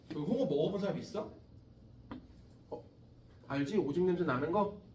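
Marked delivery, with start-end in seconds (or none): none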